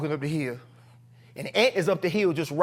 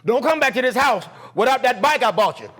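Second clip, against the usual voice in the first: loud, raised ultimatum; forceful, higher-pitched retold order; angry dad; 6 semitones higher, 5 dB louder than usual. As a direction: imitate a father laying down the law. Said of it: "My dad goes".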